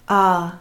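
"ah" held a little long; dragged vowel. Shown as a demonstration. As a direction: draw out "ah" as a double vowel. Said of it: "Yeah, they're dragged".

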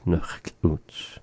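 quiet careful Nuchtluts, no L; no extra sound. Shown as a demonstration. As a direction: say Nuchtluts softly and cleanly. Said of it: "not adding any extra thing".

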